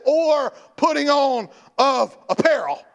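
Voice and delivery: loud, slow reading with stress on "Or"; stressing the verse. Shown as a demonstration. Preacher showing the absurd reading, slow and stressed. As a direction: lean hard on "Or". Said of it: "then the next thing says".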